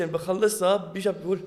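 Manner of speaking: ordinary delivery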